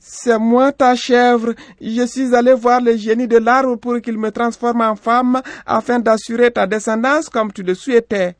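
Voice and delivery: high-pitched female character voice; loud, high young wife; reassuring young woman. A narrator's reading. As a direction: reassure him in a high, ringing voice. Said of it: "La jeune femme rassura le vieillard".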